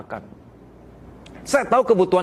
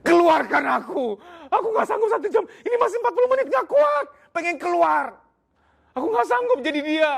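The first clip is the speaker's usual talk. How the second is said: much higher, strained pleas to be let out; desperate husband pleading; 14 semitones higher than usual. Pretend. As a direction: beg to escape, overwhelmed. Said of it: "kamu akan bilang". (suami putus asa minta dikeluarkan, suara tinggi)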